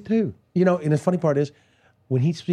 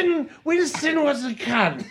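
high, slow shouted Cockney; Dudley Moore impression; 10 semitones higher, slower than usual. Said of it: "This is doing Dudley Moore".

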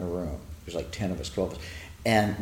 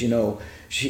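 ordinary talk, nothing marked

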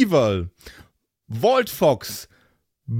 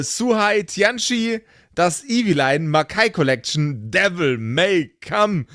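usual speaking voice

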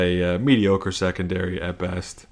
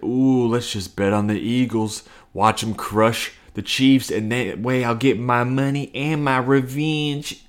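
a lightly voiced scheming gambler, slow; half-hearted sly gambler talking himself into a bet; gambling voice; 3 semitones higher than usual. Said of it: "that's my gambling voice".

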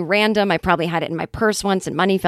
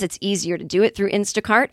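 normal talking voice

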